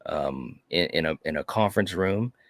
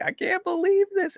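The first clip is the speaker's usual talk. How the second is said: excited disbelief. Retold or imitated. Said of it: "I'm like".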